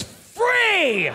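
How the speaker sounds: starts even, then a high drawn-out last word; mock Price is Right excitement; gleeful game-show yell. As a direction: stretch FREE into a long high holler. Game-show pricing before smashing things.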